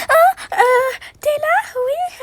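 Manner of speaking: voix haute et tremblante de peur; sursauter, prise au dépourvu; sursaut effrayé. Acted. Surprise en sanglot. (femme surprise par son mari, effrayée)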